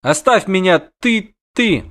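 high-voiced, spluttering girl; indignant protest. Caught girl to the witcher, indignant protest. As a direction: splutter for an insult, pitched high.

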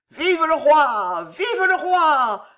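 loud, high, drawn-out hurrahs; wild cheering. A narrator's reading. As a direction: cheer wildly with the crowd. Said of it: "les cris de joie éclataient furieusement".

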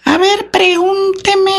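very high, slow ventriloquist puppet voice; Titino puppet voice. Retold or imitated. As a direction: squeak it out like a ventriloquist's dummy. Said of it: "esa vocecita".

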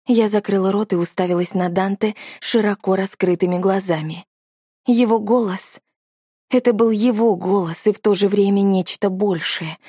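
ordinary delivery

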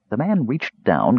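ordinary delivery

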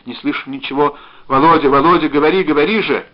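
urgent shouting, rising in volume; crying out. A narrator's reading. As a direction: call desperately down a dead phone line. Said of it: "закричала она".

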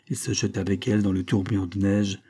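usual speaking voice

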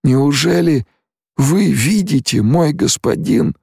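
very high, wondering question; amazed tone. A narrator's reading. Stilgar astonished that his lord sees, amazed tone. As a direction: ask in high, halting disbelief. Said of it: "изумлённым тоном проговорил Стилгар".

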